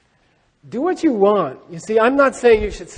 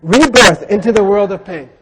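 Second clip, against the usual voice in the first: emphatic reading; 9 dB louder than usual. Shown as a demonstration. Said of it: "It says here".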